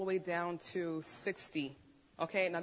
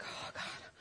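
soft whispered plea, retold; voiceless, hushed mock dread; breathed prayer; 12 dB quieter than usual. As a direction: re-enact a helpless gasped prayer under the breath. Retold fearful prayer before surgery.

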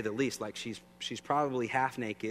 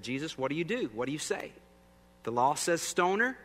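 higher, pushy challenge building louder; accusing Pharisees; 7 semitones higher, 6 dB louder than usual. Retold or imitated. Pharisees demanding an answer from Jesus, higher pushy challenge. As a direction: corner him with the question, rising in pitch and volume.

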